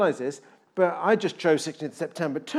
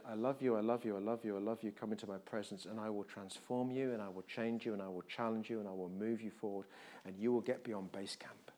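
soft, tender words from God, dropping to a whisper; God speaking tenderly; 6 semitones lower, 13 dB quieter than usual. Retold or imitated. Preacher voicing God tenderly to the church. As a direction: whisper it like God's loving promise. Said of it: "God is gonna say".

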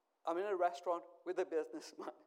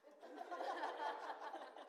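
high burst of laughter; 10 semitones higher, 7 dB quieter than usual. Real, not acted. Preacher laughing at the restaurant owner's question. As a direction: laugh at the joke.

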